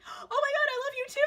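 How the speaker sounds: very high, loud mock squeal; gushing squeal. James Saint James answering the fan, gushing squeal. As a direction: squeal it back, mirroring the fan's excitement. Idol's gushing reply, acted out.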